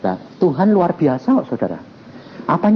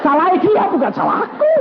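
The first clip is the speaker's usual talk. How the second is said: laughing banter. Retold or imitated. Retold banter, laughing high voice.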